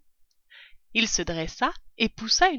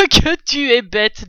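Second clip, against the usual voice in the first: scornful; 3 semitones higher, 9 dB louder than usual. Acted. Father fox scolds his cub.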